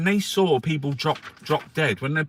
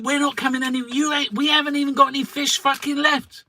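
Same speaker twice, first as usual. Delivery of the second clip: mock French fishermen; 12 semitones higher, 5 dB louder than usual.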